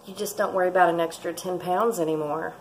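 ordinary talk

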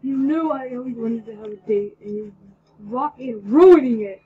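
loud, slowing angry shout; furious yell at Kira. Acted. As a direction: yell it in rage. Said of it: "he yelled angrily".

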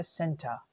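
"सेंटा" as SEN-tuh with breathy t; schwa ending. Shown as a demonstration. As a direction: end "सेंटा" on an open uh, no R. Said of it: "हमें actually इसका करना है".

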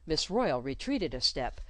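usual speaking voice

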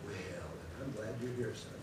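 soft, slow, a hint of a relieved mother; mother's voice. Pastor voicing the mother telling her son she's glad, soft and slow. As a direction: say it gently, won over.

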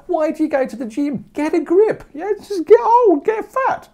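very high, loud, slowed mockery; mocking rant. Real, not acted. Teacher mocking gloomy gym-goers, very high and loud. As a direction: scoff at the gym crowd.

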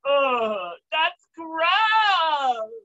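high, loud disgusted cry; disgusted shout. Real, not acted. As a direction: yell out your disgust. Host disgusted by bong water.

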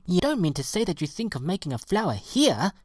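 high-pitched disbelief; scandalised pansy, pitched high; incredulous cry. A narrator's reading. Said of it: "cried the pansy".

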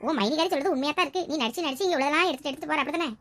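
ordinary talk

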